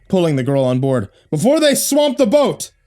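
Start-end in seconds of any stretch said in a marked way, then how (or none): 1.3-2.7 yelling. A narrator's reading. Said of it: "the captain yelled".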